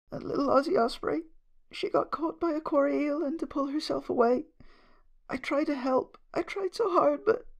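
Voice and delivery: a gentle mare fighting back tears; high tearful voice, pleading; tearful Fluttershy. Acted. Fluttershy crying over a death.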